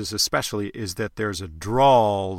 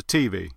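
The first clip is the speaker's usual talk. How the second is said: "T V" as TEE-vee, stressed on the T; shifted Southern stress; 3 semitones lower than usual. Shown as a demonstration. Dialect coach demonstrates Southern stress, "T V" said TEE-vee. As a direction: punch the first letter of "T V". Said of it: "the stress on words can actually shift around".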